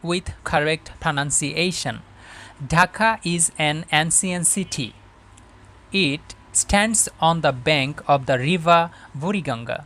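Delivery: very slow, deliberate English read-aloud; careful model reading. Shown as a demonstration. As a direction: read it phrase by phrase for learners, with long pauses. (ধীরে ধীরে, থেমে থেমে আদর্শ পাঠ)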